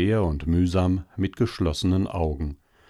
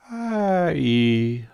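langes, träges Seufzen; Seufzer; 5 semitones higher than usual. A narrator's reading. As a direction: seufz es schläfrig heraus. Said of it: "sagte das Faultier und seufzte". (schläfriges, langgezogenes Ai)

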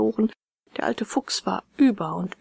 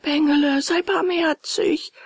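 wailing fox; 8 semitones higher, 3 dB louder than usual. A narrator's reading. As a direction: stretch the plea into a wail. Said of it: "So heulten die zwei Räuber".

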